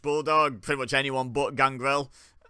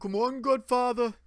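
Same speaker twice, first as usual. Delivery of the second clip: high-pitched attempt at Brummie; mock a Birmingham fan cheering, pitched up; put-on Brummie accent, badly done; 10 semitones higher than usual. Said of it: "I can't do a good Brummie accent".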